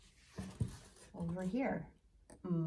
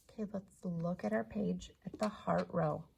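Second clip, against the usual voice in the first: normal talking voice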